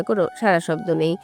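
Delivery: usual speaking voice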